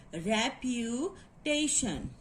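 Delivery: "reputation" slowly, split repu, tation; teacher slowly chunking "reputation"; syllable breakdown. Shown as a demonstration. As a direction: draw out "reputation" with a pause mid-word. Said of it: "we will put the syllable mark".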